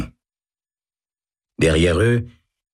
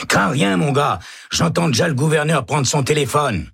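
high, cheerful boast; mock confident; 11 semitones higher than usual. A narrator's reading. Jorgensen cheering up Baca, high and breezy. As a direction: buck him up. Prisoner reassures condemned Baca.

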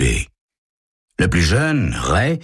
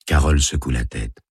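ordinary delivery

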